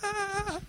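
high startled squeal; squeal of disgust. Retold or imitated. Mom in church recalls shrinking from kids, squeals high. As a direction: recoil from sticky kids' fingers with a shriek. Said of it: "I'm like".

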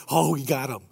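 low, hushed gloating aside; imitated snickering. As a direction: gloat quietly like the guys behind him. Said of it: "they're, like, snickering".

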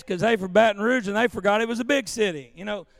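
loud, slow Louisiana Baton Rouge drawl. Retold or imitated. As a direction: play up your Baton Rouge Louisiana accent. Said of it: "My people talk like this".

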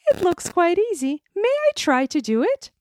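high, loud eager princess; cartoon princess. A narrator's reading. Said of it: "then she added".

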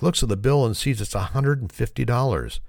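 usual speaking voice

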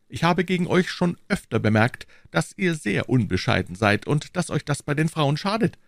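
reproving, in a high character voice; high-pitched, measured rebuke; Aramis. Acted. Aramis rebuking Porthos for his indiscretion, high-pitched.

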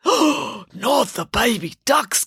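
slow, horrified gasp of words; gasping in dismay. Acted. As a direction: draw it out, aghast, hammed-up. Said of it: "gasped Hades".

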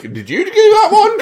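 very high, loud, laughing; high laughing mock question; laughing accusation. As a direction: squeal the question. Joking accusation at ghost.

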